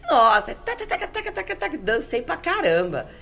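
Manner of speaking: high, thrilled beat-chanting; tapping out the samba beat with her voice; excited rhythmic taca. Retold or imitated. Excitedly reliving her dance. (empolgada, batucando com a voz)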